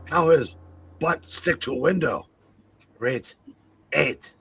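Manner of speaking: loud, halting, hammed-up delivery; silly chat-reading voice. Reading a viewer's comment and score, loud halting silly voice. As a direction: push out each phrase big, with long pauses.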